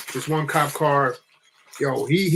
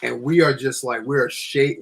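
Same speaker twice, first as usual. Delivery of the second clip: plain reading voice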